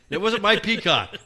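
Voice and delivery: ordinary talk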